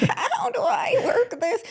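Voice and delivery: hammed-up ancient lady, very high and slow; oldest mom voice. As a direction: wail it like a baffled ninety-year-old. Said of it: "like, the oldest voice".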